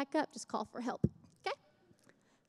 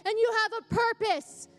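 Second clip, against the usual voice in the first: preaching; 4 semitones higher, 4 dB louder than usual. Acted. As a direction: urge the church to keep fighting. Preaching to church family.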